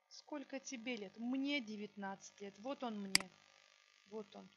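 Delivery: usual speaking voice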